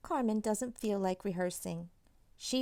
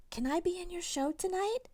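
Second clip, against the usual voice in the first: put-on high, small boy voice asking; little brother voice; 11 semitones higher than usual. A narrator's reading. Little brother asking his sister to join, high-pitched. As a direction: ask hopefully in a small boy voice. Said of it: "asks Eduardo".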